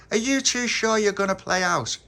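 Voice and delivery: put-on high, loud child's questioning; insistent doubt. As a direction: press them for an answer, loud and high. Child presses doubtful friends.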